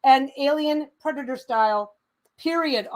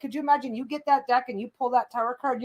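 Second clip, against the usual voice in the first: usual speaking voice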